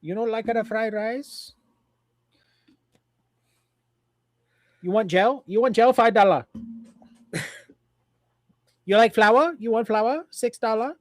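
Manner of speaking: high, loud mock Chinese vendor voice; mock Chinese accent. Co-host playing restaurant worker pushing the menu, high mock Chinese accent. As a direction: hawk it in a put-on Chinese accent.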